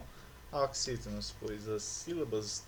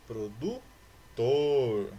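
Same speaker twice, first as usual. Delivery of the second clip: "Produtor" very slow, pausing before a loud, long TOR; stress on TOR; 7 dB louder, much slower than usual. Shown as a demonstration. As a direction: split "Produtor" and punch out the final syllable TOR. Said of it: "Tor é a sílaba tônica". ("Produtor" bem devagar, com TOR forte e alongado)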